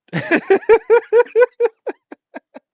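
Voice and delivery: very high, loud squealing laugh; real high squeal of laughing; shrieking laughter. Real, not acted. Cracks up at own joke.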